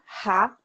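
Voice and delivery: "Ra" with an aspirated R, like an English "ha"; rhotic R sound. Shown as a demonstration. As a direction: say "Ra" with a breathy back-of-the-throat H sound. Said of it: "Tem o R rótico".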